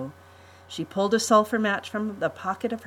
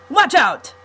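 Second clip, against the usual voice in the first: sharp loud alarm, pitched up; sudden warning shout; 9 semitones higher, 10 dB louder than usual. Acted. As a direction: yell it as the rock looms. Count warns of rock.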